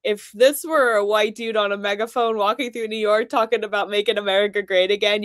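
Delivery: ordinary talk, nothing marked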